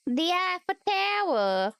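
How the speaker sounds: a hint of New York accent. Streamer doing an accent challenge for chat, light New York accent. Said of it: "A New York accent".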